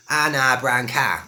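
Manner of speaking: drawn-out Cockney "ah" vowels; Cockney, H dropped, every "ow" turned to long "ah"; Cockney accent. Shown as a demonstration. Teacher showing a classic phrase in slow Cockney. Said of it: "but with Cockney".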